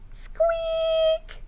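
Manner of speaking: tiny squeaky mouse sound; high mouse squeak. Acted. Mouse noise in story.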